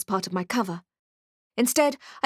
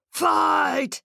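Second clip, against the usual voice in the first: orc shouting; 6 semitones higher than usual. Acted. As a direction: bellow it to start the fight. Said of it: "shouted an old orc".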